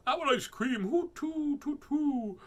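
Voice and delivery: high, loud hooting; shrieking owl call; alarmed owl. Acted. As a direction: hoot in storybook panic.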